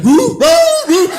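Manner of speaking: high loud dog roo-ing; dog howl imitation. Comedian imitating his dog Bush howling, high and loud. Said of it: "Bush be saying everything".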